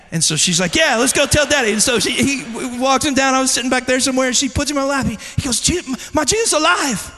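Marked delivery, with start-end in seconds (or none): 0.8-1.7 put-on raised, higher voice urging a child along; loud, high, keen reply; eager mother, imitated. Wife eagerly answering the child. 5.6-7.1 put-on high childlike announcement; eager little-boy voice, pitched up; excited child, imitated. Child announcing news to his father.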